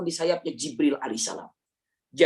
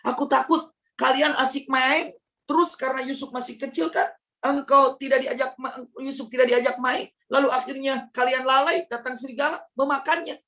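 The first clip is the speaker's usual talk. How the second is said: higher, eager pleading brothers; 9 semitones higher than usual. Acted. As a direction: coax the father into letting him go. Brothers pleading with their father.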